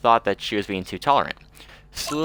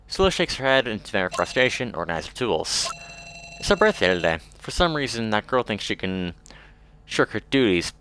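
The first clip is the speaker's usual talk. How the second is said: usual speaking voice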